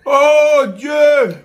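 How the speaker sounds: loud high cry at the burn; pained shout. Real, not acted. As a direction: cry out in burning pain. Reacting to chili burn.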